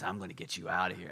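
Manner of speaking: a lame stab at an action-hero rescue line; a lightly voiced action hero, mostly his own voice; bad Chuck Norris impression. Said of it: "That's not how he talks, but I, I'm really bad with impressions".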